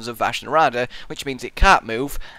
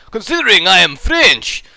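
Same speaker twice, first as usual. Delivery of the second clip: louder, higher attempt at a French accent; bad French accent; 5 semitones higher, 8 dB louder than usual. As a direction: badly fake a French accent. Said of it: "I can't do a French accent".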